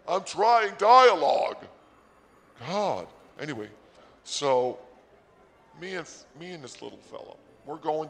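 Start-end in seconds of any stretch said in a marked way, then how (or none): 0.1-3.1 high, slow, loud protest; exasperated outburst. Real, not acted. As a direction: shout it in exasperation, stretching the words. Exasperated defence of bad audio.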